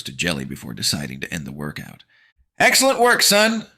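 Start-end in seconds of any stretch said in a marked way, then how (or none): 2.6-3.7 high, loud proud praise; announcing mom. Acted. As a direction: announce it like a coach. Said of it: "she announces".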